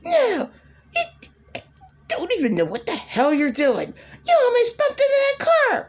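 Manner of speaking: very high shrill scream, rushing fast at the end; screaming at him. Retold or imitated. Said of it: "Screaming at him".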